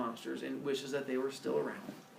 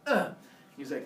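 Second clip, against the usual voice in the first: ordinary delivery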